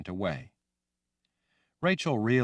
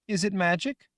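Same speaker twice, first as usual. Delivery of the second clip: put-on high, loud young girl voice; childlike Rachel; 13 semitones higher, 4 dB louder than usual. Girl Rachel asking the woman, high and loud. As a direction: sound like a scared little girl.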